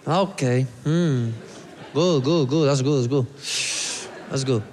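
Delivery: low, muttered approval; unimpressed staff. Acted. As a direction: humour him in a low voice. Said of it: "they're all like".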